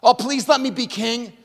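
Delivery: whiny pleading for status; hammed-up grasping prayer; mock selfish plea. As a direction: beg like a spoiled king. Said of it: "David doesn't say".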